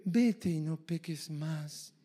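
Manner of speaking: very soft, slow, drawn-out delivery; gentle exhortation. Shown as a demonstration. Preacher showing one way to exhort a sinner, softly. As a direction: say it hushed and slowly, as gentle counsel. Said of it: "Tú, tú lo puedes decir".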